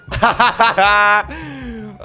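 high, loud burst of laughter; long cackling laugh. Real, not acted. Driver escaping the cop, loud laugh.

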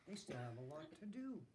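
ordinary delivery, nothing marked

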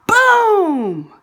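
loud, high blast of a word; dramatic boom. A narrator's reading. Narrator reading the explosion to young listeners, loud and high. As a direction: burst it out like the explosion itself. Rocket experiment explodes in story.

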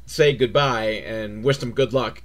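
ordinary talk

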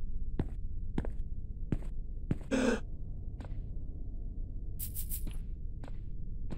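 long, soft, breathy gasp; terrified gasp. Real, not acted. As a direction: gasp as it chases you. Escaping the monster.

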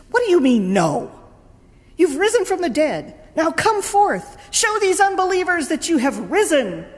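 high, loud exasperated demand; indignant scolding. Retold or imitated. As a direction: scold him into coming out. Jesus scolds reluctant Lazarus.

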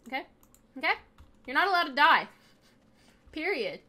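high loud scolding at the Sim; stern shout. Real, not acted. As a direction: shout it like a worried parent laying down the law.

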